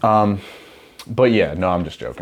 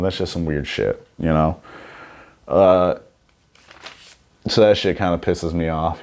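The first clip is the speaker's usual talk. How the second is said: ordinary talk, nothing marked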